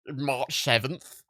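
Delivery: a hint of a smug know-it-all American, slow at the end; mocking an American. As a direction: lightly play a smug know-it-all correcting people. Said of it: "you got Americans coming in going".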